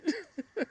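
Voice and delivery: high broken sob; a real sob catching. Real, not acted. Crying at brother's memory.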